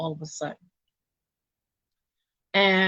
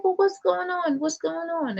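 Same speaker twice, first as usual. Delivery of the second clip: higher-pitched, bewildered retold question; a pitched-up, confused plea; alarmed confusion; 10 semitones higher than usual. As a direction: sound lost and alarmed, asking what's happening. Confused as ambulance is called.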